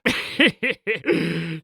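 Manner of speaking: short, sly villain snicker; wicked little laugh; evil chuckle. Acted. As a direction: snicker low in a quick burst.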